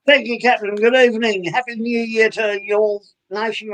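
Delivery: lightly mock-regal new year address; mock formal address. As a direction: greet the nation like a dignitary. Guest replies in formal host style.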